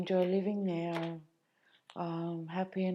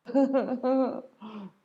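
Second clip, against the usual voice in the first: a sudden burst of laughter, higher and louder; short real laugh; 6 semitones higher, 6 dB louder than usual. Real, not acted. Woman recalling having nowhere to go bursts out laughing. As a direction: laugh it off awkwardly.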